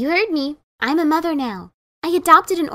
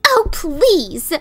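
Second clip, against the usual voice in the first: scolding. Acted. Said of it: "Ditzy scolded".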